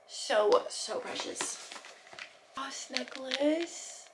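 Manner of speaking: ordinary delivery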